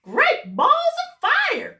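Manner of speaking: high, loud, slow goose cry. Acted. Goose scattering the other birds, high loud cry. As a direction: shout it out like a startled goose. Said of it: "cried the goose".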